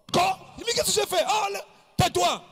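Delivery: put-on high yelling as a bullied kid; mock childhood yelling. Little brother yelling as big brother hits him, high mock shout.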